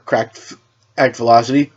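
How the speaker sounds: ordinary delivery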